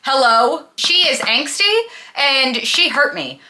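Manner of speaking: normal talking voice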